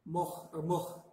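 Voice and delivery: moch with a rough throaty ch, no W sounded; guttural ending. Shown as a demonstration. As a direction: end moch with a scrape in the throat. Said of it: "You don't pronounce the W".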